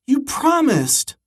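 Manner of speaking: high, slow, pleading; a child reminding the principal of a promise, high and slow. A narrator's reading. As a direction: stretch it out like a let-down kid.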